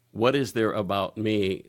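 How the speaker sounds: ordinary talk, nothing marked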